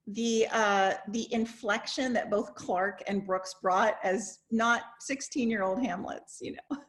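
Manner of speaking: ordinary talk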